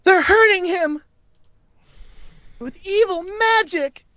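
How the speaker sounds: very high-pitched outraged cow voice; shrieked accusation from an angry cow; angry shout. Acted. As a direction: cry out in high, hammed-up outrage, dragging the final words. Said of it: "and she just shouts".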